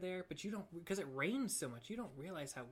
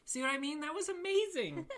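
very high, loud amazement; delighted praise; 13 semitones higher, 7 dB louder than usual. Real, not acted. Co-host applauding the impression, high excited gushing. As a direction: gush at full pitch over a friend's performance.

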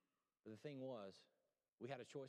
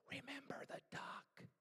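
hissed threat under the breath; little sister whispering a threat to her brother; menacing whisper; 9 semitones higher, 7 dB quieter, slower than usual. Acted. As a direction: whisper it like a sly blackmail.

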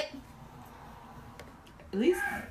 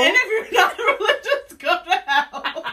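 laughing; 9 semitones higher, 5 dB louder than usual. Retold or imitated. Mocking preachy religious people.

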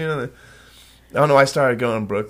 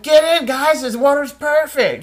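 put-on high-pitched drunken holler; calling out; 10 semitones higher, slower than usual. Drunk swimmer urging friends in, high call.

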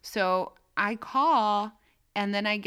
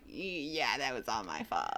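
much lower, softer, sheepish mutter; sheepish admission; 9 semitones lower, 7 dB quieter, slower than usual. Retold or imitated. Caller admitting her own mistake, sheepish and quiet. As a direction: own the mistake under your breath.

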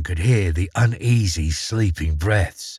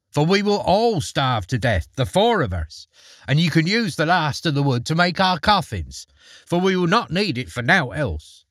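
mildly harsh, higher pitched scolding; harsh wife; 6 semitones higher than usual. A narrator's reading. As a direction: press the grim point home with a touch of bitterness. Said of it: "retorted his wife in a harsh voice".

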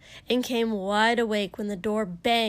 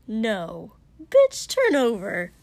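sharp; 7 dB louder, slower than usual. Acted. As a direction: bark the order. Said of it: "Katsuki said sharply".

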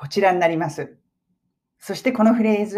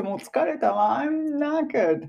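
exhausted parent; 8 semitones higher, 4 dB quieter, slower than usual. Acted. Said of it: "思ってるんじゃないかな".

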